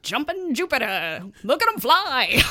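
hammed-up old-timey newsreel voice; old newsreel announcer. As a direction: belt it like a fifties newsreel narrator. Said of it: "old newsreels".

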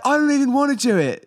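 put-on very high, loud British accent; hammed-up jolly British dude; Russell Brand. Demonstrating top-tier male British accent, high and loud. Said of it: "the Russell Brand is the highest tier".

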